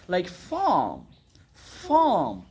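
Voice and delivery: ফার্ম stretched to "faaam", no r; falling, elongated British ফার্ম; drawn-out British vowel. Shown as a demonstration. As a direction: hold the long vowel in ফার্ম, r silent. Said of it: "এখানে তো একটা টান আছে".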